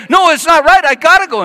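high, loud frantic pleading; a worried friend losing it at the door; high, loud, panicky guy; panicked friend. Retold or imitated. Guy insists.

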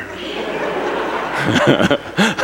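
soft helpless airy laugh; breathless laugh. Real, not acted. Dad cracking up at his kid's remark, soft airy laugh. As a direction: crack up quietly. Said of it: "I just started laughing".

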